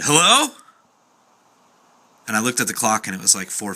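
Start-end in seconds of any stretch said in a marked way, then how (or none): 0.0-0.5 a loud, high "Hello?" into the dark apartment; calling out. Retold or imitated. Waking to an open front door, calling out loud. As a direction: call out, alarmed, to whoever is there.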